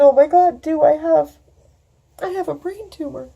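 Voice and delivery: high, loud, broken voice, fading at the end; sobbing out the news; crying. Real, not acted. Telling her friend about the tumor, sobbing.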